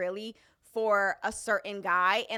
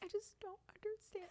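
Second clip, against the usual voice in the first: mock crying whine; 11 semitones higher, 17 dB quieter than usual. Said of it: "He just".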